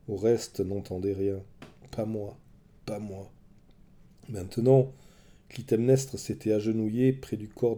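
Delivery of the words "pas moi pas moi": soft, fading repeated words; the cry dying away in his head; faint inner echo. A narrator's reading. Oreste hearing the child's cry inside, soft and fading.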